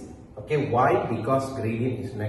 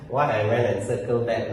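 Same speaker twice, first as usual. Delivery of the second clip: ordinary delivery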